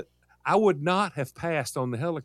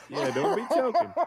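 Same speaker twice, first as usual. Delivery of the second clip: laughing; 8 semitones higher than usual. Real, not acted. Teasing co-host, laughing.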